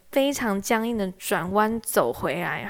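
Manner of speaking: ordinary talk, nothing marked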